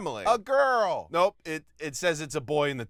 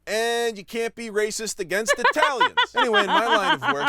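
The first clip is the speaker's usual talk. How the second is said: laughing; 7 semitones higher, 5 dB louder than usual. Real, not acted. Laughing at own joke.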